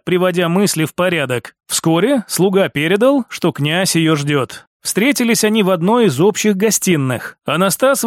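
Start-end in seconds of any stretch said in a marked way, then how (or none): none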